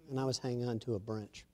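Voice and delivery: quiet, low heartfelt testimony; preacher quietly sharing his own salvation; soft testimony. Real, not acted. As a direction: confide a life-changing moment softly.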